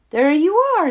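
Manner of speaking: high, loud happy exclamation; the relief of finding him; Mama greets Little Quack, high and delighted. A narrator's reading.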